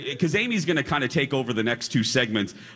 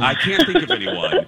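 laughing mock echo; 3 dB louder than usual. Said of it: "repeats cohost's line mocking".

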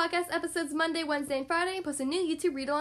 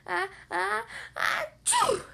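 fake sneeze; 6 semitones higher, much slower than usual. Monkey about to sneeze.

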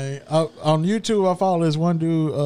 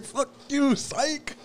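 high, soft taunting psych; high mock taunt; 10 semitones higher, 5 dB quieter, much slower than usual. Manager taunting the worker, high teasing voice. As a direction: pitch it up and tease. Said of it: "He like".

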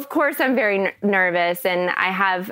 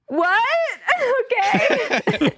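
tiny, very high starstruck reply; stunned disbelief; 14 semitones higher, much slower than usual. Retold or imitated. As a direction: squeak it out in mock shock. Retold stunned reply on the phone.

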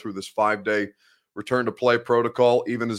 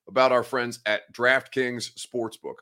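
normal talking voice

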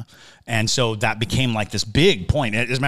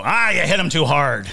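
higher, louder gruff pirate scolding; 7 semitones higher, 6 dB louder than usual. Retold or imitated. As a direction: bark it like an old sea dog. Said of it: "I love your pirate voice".

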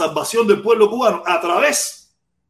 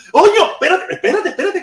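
high, louder urgent call; excited call to wait; 11 semitones higher, 4 dB louder than usual. Real, not acted. Host urgently stopping his live chat, high and excited. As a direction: stop the chat, much higher in pitch.

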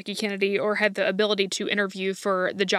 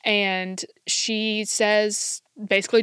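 usual speaking voice

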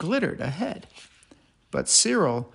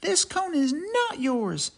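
high squirrel voice; 8 semitones higher than usual. Acted. Cyril arguing over the cone.

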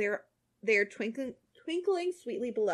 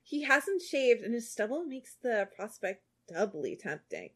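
normal talking voice

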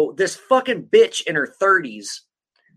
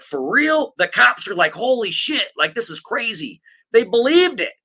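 higher, hammed-up disbelief; imitating shocked cops; mock astonishment; 7 semitones higher, 3 dB louder than usual. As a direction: play the gullible cops amazed. Mocking the gullible cops.

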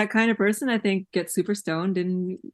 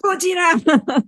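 higher, drawn-out monster-movie cry of Gojira; excited Gojira yell; 9 semitones higher, 5 dB louder than usual. Retold or imitated. Yamamoto gets super excited at the T-Rex, high slow shout. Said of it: "And he goes".

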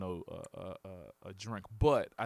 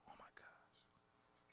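slow breathless whisper of mock amazement; mock awed whisper; 35 dB quieter, much slower than usual. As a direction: whisper it slowly, pretending to be stunned. Mock awe at partner's speech.

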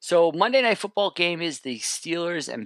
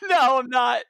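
laughing; 12 semitones higher than usual. Real, not acted. Still giggling, can't stop.

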